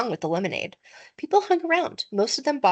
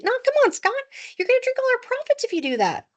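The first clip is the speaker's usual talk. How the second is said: put-on pitched-up kid's protest, half pleading; young boy's high, pleading protest. A narrator's reading. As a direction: squeak out the complaint like a young boy.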